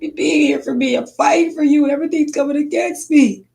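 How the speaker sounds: a milder retold plea; begging Father for help; pleading.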